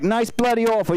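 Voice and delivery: ordinary talk, nothing marked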